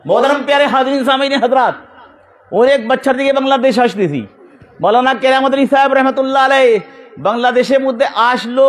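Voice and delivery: high, ringing preaching chant-like address; sermon oratory. Acted. Preacher recounting his forefathers to the gathering, declamatory oratory.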